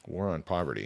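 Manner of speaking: quiet reflective echo; musing echo. Real, not acted. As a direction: mull the phrase over to yourself.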